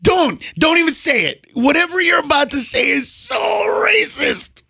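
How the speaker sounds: high, scandalized scolding; mock outrage. Mock scolding over a racist joke.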